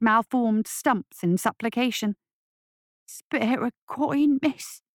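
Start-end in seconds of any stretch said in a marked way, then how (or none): 3.1-4.8 slow, high gurgling beggar croak; bubbling croak. Acted. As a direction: croak it wetly, dragging each word. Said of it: "croaked out a call for alms in a bubbling voice".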